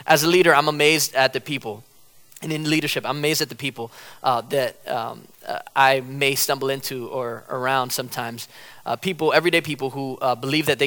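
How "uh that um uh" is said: ordinary talk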